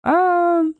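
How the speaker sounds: very high, slow sustained call; long high-pitched holler; high held wail. Real, not acted. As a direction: stretch each word out high.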